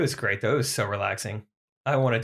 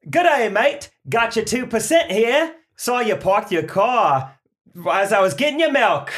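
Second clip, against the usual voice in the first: high, loud, hammed-up Australian accent; loud comic Aussie voice, pitched high; 10 semitones higher, 5 dB louder than usual. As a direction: play an Aussie milkman, broad and bright. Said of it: "milkman in, from Australia".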